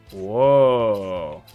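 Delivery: normal talking voice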